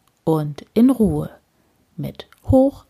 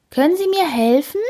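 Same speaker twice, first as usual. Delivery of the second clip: put-on high pleading old lady; Frau Usebuse asking; 10 semitones higher, faster than usual. A narrator's reading. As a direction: ask the neighbour hopefully. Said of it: "fragt Frau Usebuse".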